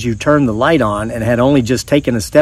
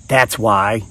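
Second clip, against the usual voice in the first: a low, gravelly creature voice, softer; 3 semitones lower, 5 dB quieter than usual. Acted. Rollie answering the startled narrator, low and gravelly. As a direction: growl it low like a small monster. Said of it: "he said in a low, gravelly voice".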